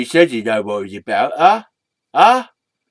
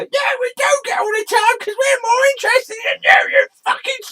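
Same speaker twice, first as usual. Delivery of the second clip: high puppet voice shouting a taunt; a cheeky puppet yelling insults; high squeaky mocking yell; red sock puppet; 5 dB louder than usual.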